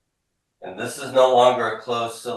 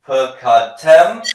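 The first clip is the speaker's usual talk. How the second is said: slow, loud, high, rising syllables; repeat-after-me model; 6 semitones higher, 12 dB louder, slower than usual. Shown as a demonstration. Teacher leading a class drill, slow loud rising model word. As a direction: sound out each syllable for the class. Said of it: "Repeat after me".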